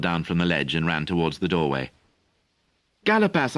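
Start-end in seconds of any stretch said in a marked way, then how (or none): 3.0-3.6 much higher, loud call across the dark; shouting a name. A narrator's reading. Young Merlin shouts for Galapas, alarmed. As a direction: shout for him, urgent. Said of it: "I shouted".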